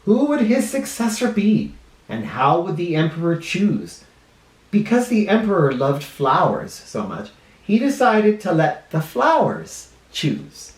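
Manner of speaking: put-on lively storybook voice for kids; reading to children. A narrator's reading. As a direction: read it like a children's librarian.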